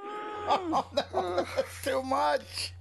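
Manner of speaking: shocked. Real, not acted. Reacting to disgusting story.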